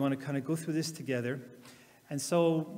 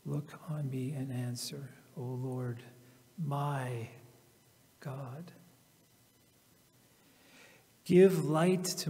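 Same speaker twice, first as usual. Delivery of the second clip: slow, reflective reading with long pauses; slow scripture reading; slower than usual. Acted. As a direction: let each line sink in like a prayer.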